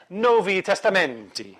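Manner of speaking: mock Italian-accented Latin; hammed-up Italian lilt on the Latin. Teacher showing the class how to read the Vulgate, Italian accent. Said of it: "with an Italian accent".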